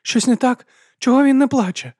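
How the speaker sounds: very high, loud, panicked questioning; trembling voice. A narrator's reading. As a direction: cry out in rising alarm. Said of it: "Її голос дрижав".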